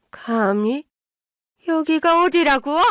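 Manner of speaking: affronted mother-in-law, climbing in pitch; indignant challenge. A narrator's reading. Mother-in-law confronts returning daughter-in-law, indignant. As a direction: rise into high indignation.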